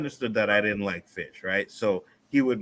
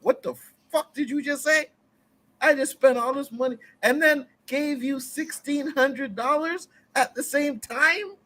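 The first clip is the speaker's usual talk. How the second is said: much higher, indignant ranting voice; mock outraged parent; 13 semitones higher than usual. Parent scolding the ungrateful daughter, mock outraged and high. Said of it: "I'm like".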